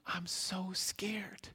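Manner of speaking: put-on slow, trembling little-girl whisper; frightened whisper. Lost little girl telling the stranger her fear, whispered. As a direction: breathe it out like a scared lost child.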